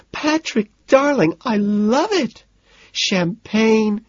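very high-pitched delighted squeal. A narrator's reading. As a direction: squeal it slowly, with gushing delight. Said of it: "sticks her head out and squeals".

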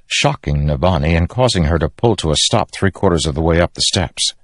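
ordinary delivery

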